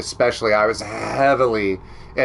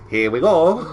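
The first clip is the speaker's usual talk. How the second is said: normal talking voice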